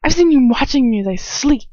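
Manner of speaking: high, loud defiant yelling; screaming defiance. Acted. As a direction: throw it in his face.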